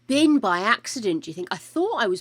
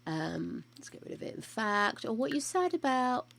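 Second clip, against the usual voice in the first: normal talking voice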